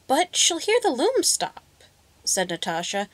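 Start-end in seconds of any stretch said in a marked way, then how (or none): 0.1-1.6 put-on girl's voice. A narrator's reading. Natasha worried to the cat.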